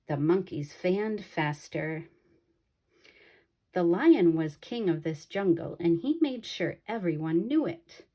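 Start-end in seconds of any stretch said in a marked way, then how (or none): none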